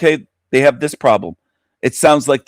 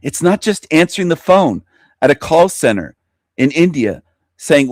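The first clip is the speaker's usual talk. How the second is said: usual speaking voice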